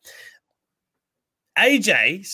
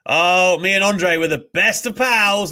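high, loud hammed-up boasting; mock Hogan boast; 6 semitones higher, 6 dB louder, slower than usual. Mocking Hogan's claim of friendship with Andre, high loud hammed-up boast. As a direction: puff it up like a revisionist legend. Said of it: "revisionist in like".

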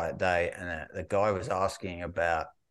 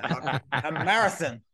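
laughing out the word in disbelief; a real laugh running through the words; 7 semitones higher, 7 dB louder than usual. Real, not acted. Laughing at the shop lad's answer, in disbelief.

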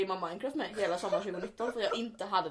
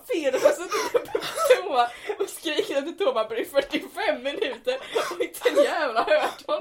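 laughing retelling; 5 semitones higher, 7 dB louder than usual. Retold or imitated. Retells her reply to mum.

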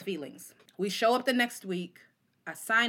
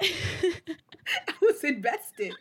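high, slow laughing drawl; laughing slowly; 10 semitones higher, 4 dB louder, much slower than usual. Real, not acted. Guest repeats confession, slow laughing. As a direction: stretch it out through laughter.

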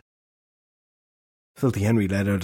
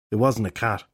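ordinary delivery, nothing marked

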